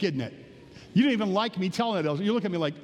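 ordinary talk

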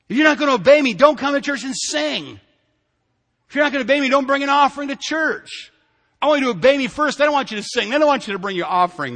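high, loud sermon delivery; forceful preaching. Acted. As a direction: preach it as God's firm demand.

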